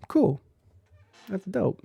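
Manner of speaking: ordinary delivery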